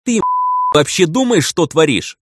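loud, high furious scolding; angry. Acted. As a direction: shout it at him in fury. Furious girl confronts reckless pilot.

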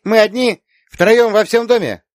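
put-on high, loud child's question; small girl working it out, pitched high; little girl marvelling. A narrator's reading. Little girl asks aunt if they are home alone, marvelling.

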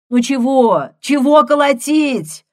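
loud annoyed nurse yelling; shouting. Acted. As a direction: yell it out, irritated. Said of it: "крикнула она".

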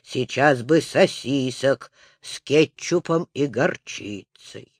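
low, wistful musing; dreamy drawl. A narrator's reading. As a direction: daydream aloud, keeping it low. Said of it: "мечтательно протянул Пупсик".

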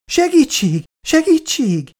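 high-pitched mock cries for help; playful shrieking. Grandmother playing scared of the dog, playfully shrieking. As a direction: squeal in pretend terror. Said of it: "Sikongatott játékosan Szabóné".

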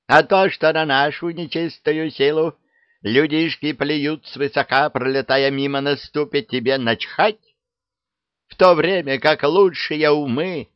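high, loud rousing rant; fiery witch speech. Acted. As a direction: stir them up like a zealous hag. (пламенная речь ведьмы)

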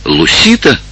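high-pitched surprised question. A narrator's reading. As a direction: ask the name in amazement, pitch leaping up. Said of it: "с удивлением спросила Долорес". (с удивлением)

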